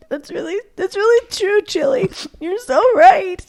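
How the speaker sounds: high, teary voice answering the TV; choked-up high pitch, moved to tears; crying along with the TV mom; tearful, moved. Retold or imitated. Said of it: "And I was, like, crying".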